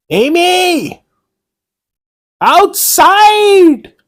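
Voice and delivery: high, loud call across the ruins. Acted. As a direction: shout her name from inside the keep. Calling Amy from inside keep.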